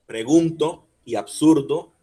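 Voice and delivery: "Pregunto y absurdo", agudo y fuerte en la U; fuerza en la U. Shown as a demonstration. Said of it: "fuerza en la U". ("Pregunto y absurdo" con "gun" y "sur" resaltados)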